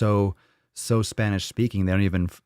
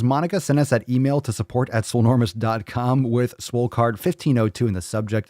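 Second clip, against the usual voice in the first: usual speaking voice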